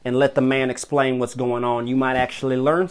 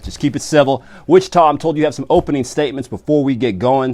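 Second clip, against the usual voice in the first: usual speaking voice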